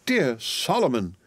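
high, drawn-out cry of recognition; overjoyed exclamation, slow on the name. A narrator's reading. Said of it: "cried Miss Pross".

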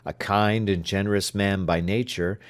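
ordinary delivery, nothing marked

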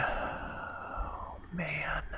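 a stunned whisper; whispered gasp. Real, not acted. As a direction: barely breathe it out. Reacting to a confession.

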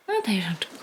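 whispered coaxing. Real, not acted. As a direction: coax the dog in a whisper. Whispering to the dog. (szept do psa)

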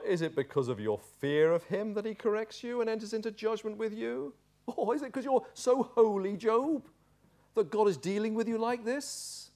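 high-pitched mocking questions; sneering. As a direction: sneer at him with mock concern. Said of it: "his tone is sneering".

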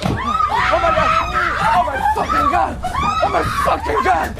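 shrieking half-hysterical laughter of terror; terrified screaming. Acted. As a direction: scream it in sheer panic, high. Terrified scream while fleeing.

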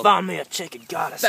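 Australian accent attempt. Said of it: "I can't do an Australian accent that well".

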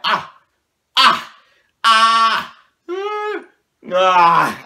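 high loud frightened ah cries; panicked kid wails. Retold or imitated. Kid panicking at a bee in the car seat, loud high wails. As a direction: wail in panic. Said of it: "I'm like".

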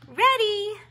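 keen and bright answer; eager little bear. A narrator's reading. Little bear answering his sister eagerly. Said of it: "nodded Murray".

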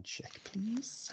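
unvoiced whispered please. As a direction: breathe it out secretively. GM whispers a request.

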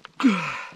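long gasping exhale after holding breath; big exhale after one breath. Real, not acted. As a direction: let the air out in one heavy sigh. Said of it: "I'm gonna do this in one breath".

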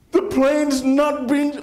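sharp high gasp then high slow disbelief; boy amazed the plane passes through the clouds; astonished gasp. Retold or imitated. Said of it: "and I'm thinking".

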